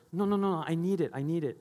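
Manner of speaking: put-on whiny child. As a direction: plead like a kid clutching her blanket. Daughter clinging to blanket.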